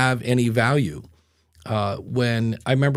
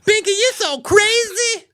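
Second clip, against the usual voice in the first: very high, louder falsetto squeal; squeaky falsetto catchphrase; 4 dB louder than usual. Pretend. Host performs his catchphrase on request, piercing falsetto. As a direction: shriek it way up high like a morning zoo woman. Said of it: "record you for an ad ... where you just say".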